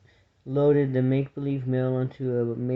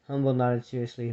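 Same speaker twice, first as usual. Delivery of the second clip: usual speaking voice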